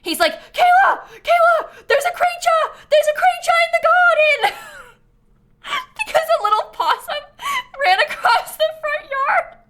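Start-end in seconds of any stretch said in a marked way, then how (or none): 0.5-4.6 very high, loud panicked yell in a mock British accent; British husband yelling. Husband yelling to his wife about a creature outside, mock British yell. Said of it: "he starts yelling". 5.6-9.6 helpless high, loud laughing voice; laughing hard. Real, not acted. Streamer explaining her possum story to chat, cracking up.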